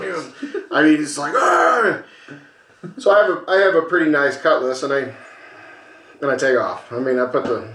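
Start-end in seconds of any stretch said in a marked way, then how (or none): 1.3-2.0 high, mock "argh" of rage; imitated angry growl. Storyteller imitating the enraged other driver, worked-up growl. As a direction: mimic a worked-up driver's snarl. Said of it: "he's getting all worked up".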